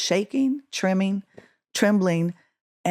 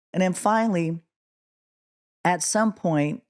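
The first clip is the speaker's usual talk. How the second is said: ordinary talk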